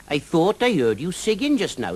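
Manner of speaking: high, lilting Welsh dragon voice, friendly and teasing; a jolly Welsh dragon drawing out his words. Acted. Welsh dragon Mister Jones.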